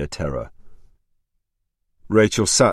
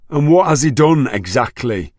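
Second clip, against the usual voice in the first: loud, cold challenge; angry demand; 8 semitones higher, 7 dB louder than usual. A narrator's reading. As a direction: demand it icily.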